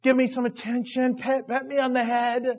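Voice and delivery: a touch of whiny begging; begging dog. Acted. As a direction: plead like a needy puppy wanting attention. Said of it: "like I'm a dog with my tongue".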